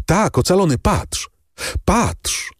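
high, eager exclamations; excited urging. A narrator's reading. Daughter shows her father the purse, excitedly urging. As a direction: pitch lifted, urging him to see.

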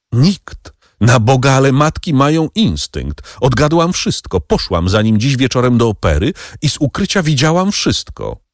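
wyższy, błagalny kobiecy głos pełen żalu; błagająca Mercedes. Acted. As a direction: zrozpaczona kobieta prosi dawnego ukochanego. Mercedes pleads as a mother. (Mercedes błaga Edmunda o litość, wyższym, rozedrganym głosem)

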